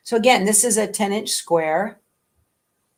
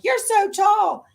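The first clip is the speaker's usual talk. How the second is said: high, slow amazed exclamation; gushing onlooker; 14 semitones higher, 6 dB louder, slower than usual. Retold or imitated. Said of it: "people see me and they're like".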